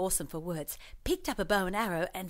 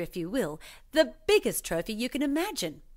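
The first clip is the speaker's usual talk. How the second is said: usual speaking voice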